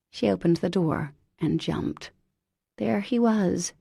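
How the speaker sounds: ordinary delivery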